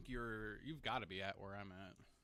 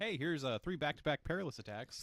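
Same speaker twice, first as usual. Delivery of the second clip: louder, higher gleeful boss voice; playful mock boss warning; mock boss announcement; 5 semitones higher, 7 dB louder than usual. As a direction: announce the attacks like a cocky boss. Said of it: "voicing the boss".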